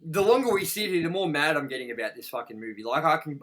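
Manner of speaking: usual speaking voice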